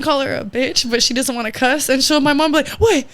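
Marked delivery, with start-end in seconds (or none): 2.7-3.1 mocking mom. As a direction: taunt grandma to finish the insult. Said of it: "Mocking her?".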